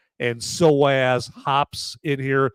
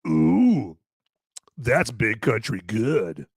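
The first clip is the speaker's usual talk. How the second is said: savoring; 4 semitones lower, much slower than usual. Real, not acted. First sip of the beer.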